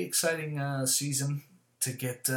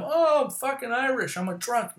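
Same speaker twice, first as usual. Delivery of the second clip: mocking excuse; 10 semitones higher, 6 dB louder than usual.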